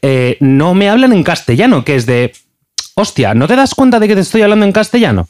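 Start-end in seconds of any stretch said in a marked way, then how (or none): 3.0-5.3 high-pitched exasperated protest. Retold or imitated. Telemarketer reenacts his reaction to callers, exasperated. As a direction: act out losing patience with a caller.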